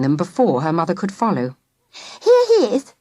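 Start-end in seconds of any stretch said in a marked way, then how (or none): 2.2-2.9 put-on higher, bright girl voice announcing. A narrator's reading. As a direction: present the vet proudly. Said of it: "she announced".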